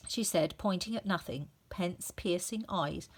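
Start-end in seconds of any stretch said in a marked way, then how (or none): none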